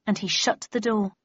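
ordinary delivery, nothing marked

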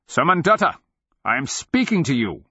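loud, high, demanding call; commanding shout. A narrator's reading. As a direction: shout to make him turn and answer. Wizard challenges the man across courtyard.

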